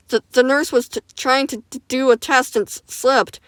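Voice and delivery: stuttering. Acted. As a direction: stammer out the accident. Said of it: "stuttering while he talks".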